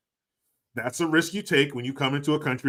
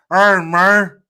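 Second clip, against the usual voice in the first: put-on loud, higher Scarface Cuban accent; Al Pacino Scarface; 5 semitones higher, 13 dB louder than usual. Mocking how everybody copies Scarface, loud Cuban-accent greeting. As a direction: bark it like Tony Montana. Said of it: "Fucking Al Pacino".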